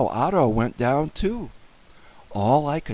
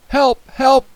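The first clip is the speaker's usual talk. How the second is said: high, loud call for help; panicked cry; 13 semitones higher, 10 dB louder than usual. A narrator's reading. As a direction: cry out urgently for rescue. Boy phones police for help.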